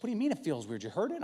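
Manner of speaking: high-pitched wife imitation; mock wife pressing for answers; questioning wife. Wife checks on husband's weird arm, mock questioning.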